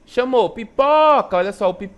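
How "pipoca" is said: "pipoca" called high and loud, holding the "po"; calling, stretched syllable. Shown as a demonstration. Teacher calling the word to show pupils, "pipoca" with long high "po". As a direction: call out "pipoca" and stretch its middle "po". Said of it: "como ele estica um pouco mais".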